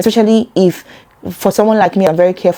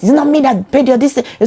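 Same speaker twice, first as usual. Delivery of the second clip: mock reproach; 6 semitones higher than usual. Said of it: "a talking point".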